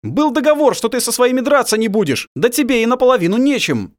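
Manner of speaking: loud high angry yell; angry shouting. Acted. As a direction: yell it over the fight. Angry shouting during fight.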